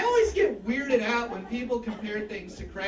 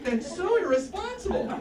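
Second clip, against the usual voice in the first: normal talking voice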